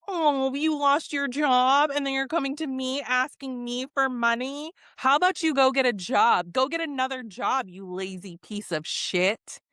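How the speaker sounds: hammed-up influencer berating someone from above; mean and nasty. Influencer answering a woman's plea for money, mean and nasty. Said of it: "just mean and nasty".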